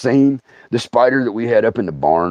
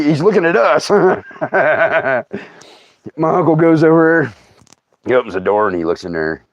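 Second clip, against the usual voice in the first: ordinary delivery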